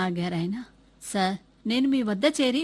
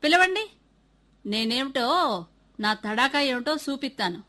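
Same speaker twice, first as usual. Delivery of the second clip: angry shout; 6 semitones higher, 6 dB louder than usual. Acted. Angry father demands the teacher.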